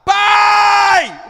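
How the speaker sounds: loud, high-pitched yell held long; shouting. Shown as a demonstration. Preacher showing a bus passenger yelling while reading, shouting. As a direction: cry it out at full volume, drawn out. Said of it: "você vai gritar".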